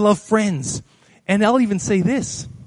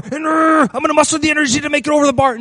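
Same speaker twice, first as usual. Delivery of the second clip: higher, grunting, rushed heave; mock straining effort; 5 semitones higher, 3 dB louder, faster than usual. Believer mustering energy to clear the bar, mock straining. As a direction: act out hauling yourself over, fast and strained.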